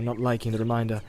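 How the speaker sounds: ordinary delivery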